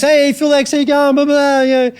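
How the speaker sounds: high, loud, hyped-up greeting. Retold or imitated. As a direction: gush like an excitable rocker backstage. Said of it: "was being all [name]".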